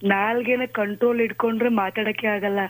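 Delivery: usual speaking voice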